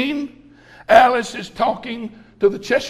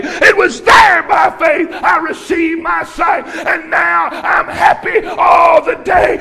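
full-force preaching; 6 semitones higher, 7 dB louder than usual. Acted. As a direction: preach it hard to the church, every word stretched. Preaching climax, shouting.

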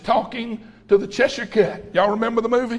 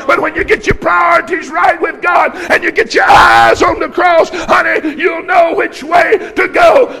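full-force preaching; 6 semitones higher, 7 dB louder than usual. Acted. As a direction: preach it hard to the church, every word stretched. Preaching climax, shouting.

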